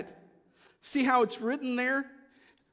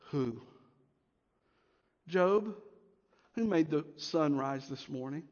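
low, quiet, solemn voice of God; voicing God to Job; 8 semitones lower, 5 dB quieter than usual. God answering Job's questions, low and solemn.

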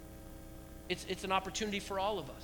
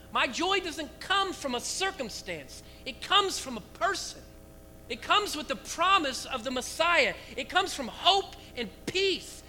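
preaching at full force; 7 semitones higher, 4 dB louder than usual. Acted. Preacher to church, fervent proclamation. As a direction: build to the altar call.

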